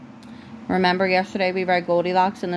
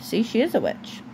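usual speaking voice

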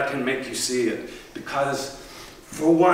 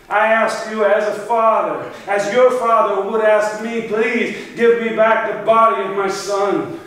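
raised, urgent plea from a father; a king pressing his plea with a raised voice; loud pleading; 6 semitones higher, 5 dB louder than usual. Acted. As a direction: beg louder, as one father to another. Father begs for son's body.

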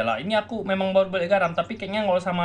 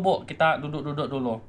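ordinary talk

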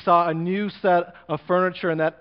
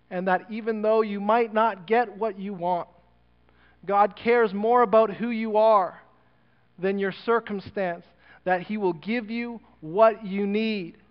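preaching; 4 semitones higher than usual. Real, not acted. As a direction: drive it home to the church. Preacher builds the sermon climax.

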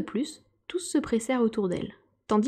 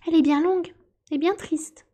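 higher, louder sorrowful exclamation; sighing lament; 8 semitones higher, 5 dB louder than usual. A narrator's reading. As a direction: lament it with a sigh in a raised voice. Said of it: "s'exclama la souris en soupirant". (plainte soupirée, voix haut perchée)

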